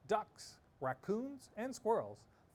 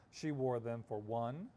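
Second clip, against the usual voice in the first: plain reading voice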